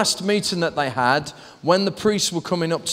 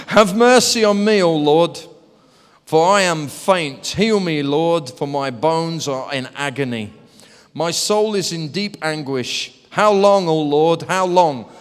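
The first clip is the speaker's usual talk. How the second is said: measured plea with loud cries to God; impassioned psalm reading; 5 dB louder than usual. Acted. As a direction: read scripture as an earnest lament. Reads psalm aloud.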